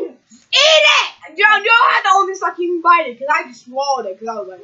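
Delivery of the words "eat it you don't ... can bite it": higher, louder commands; loud urging. Real, not acted. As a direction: push them loudly to eat. Urging friend to eat.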